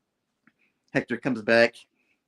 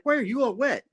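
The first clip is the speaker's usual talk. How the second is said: higher, puzzled question; puzzled Spidey; 13 semitones higher than usual. Retold or imitated. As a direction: ask it, half-amused. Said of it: "He's like".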